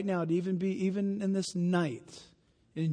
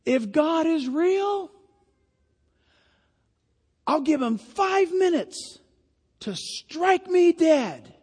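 high, slow, shouted challenge from a heckler; heckler's shout; 10 semitones higher, 6 dB louder, slower than usual. Retold or imitated. Preacher acting out a heckler shouting a dare at Graham. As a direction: fling the dare up at the stage. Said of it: "someone shouted from the crowd".

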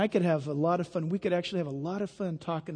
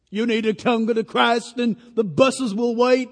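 high, louder mock evangelist appeal; imitating Billy Graham; 4 semitones higher, 9 dB louder than usual. Preacher imitating Billy Graham's altar call to the crowd. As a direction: ham up the famous altar call. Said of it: "He's preaching".

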